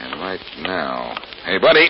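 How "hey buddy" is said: high, loud call to a stranger; loud confrontational hail; challenging shout. Acted. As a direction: call him out sharply. Confronts man following the couple.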